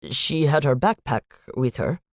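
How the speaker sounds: put-on light Indian accent, statements lifting at the ends; a hint of an Indian student, worried uptalk. Student telling the agent about Noelle, light Indian accent. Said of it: "a young man with an Indian accent".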